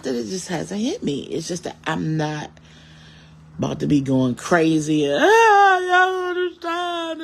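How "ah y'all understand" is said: high, loud, drawn-out wail of fake grief; parodying how she is expected to fall apart; mock breakdown. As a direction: ham up the crazy mourner, slow and high.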